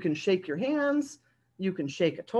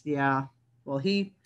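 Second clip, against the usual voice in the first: usual speaking voice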